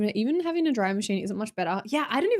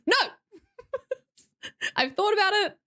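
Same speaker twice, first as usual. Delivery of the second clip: laughing protest; 11 semitones higher than usual. Pretend. Mock protest at being told.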